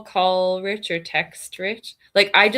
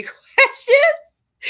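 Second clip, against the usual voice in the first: ordinary delivery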